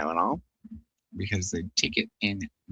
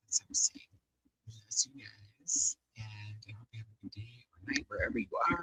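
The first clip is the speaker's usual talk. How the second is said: soft whisper; 8 dB quieter than usual. Real, not acted. Thanking viewers at stream end, whispered. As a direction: whisper farewell to the chat.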